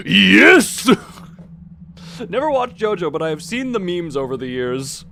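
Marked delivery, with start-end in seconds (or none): 0.1-0.9 loud triumphant yes; hammed-up victory shout; meme yell. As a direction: shout it like an anime hero. Final JoJo yes shout.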